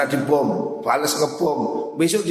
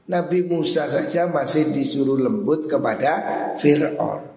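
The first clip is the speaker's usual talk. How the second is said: ordinary delivery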